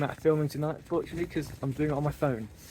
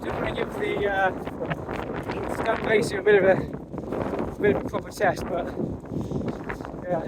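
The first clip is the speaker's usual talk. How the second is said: loud, raised voice in gale; shouting over wind; 7 semitones higher, 5 dB louder than usual. Real, not acted. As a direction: call out over howling wind. Windy summit, shouting over gusts.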